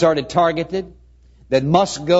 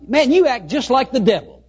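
high-pitched, indignant boss; mock reprimand in a raised pitch; acted rebuke; 8 semitones higher than usual. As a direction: scold as an astonished boss, voice pitched up. Boss rebukes employee in imagined scene.

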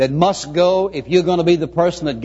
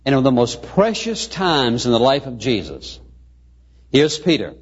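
ordinary talk, nothing marked

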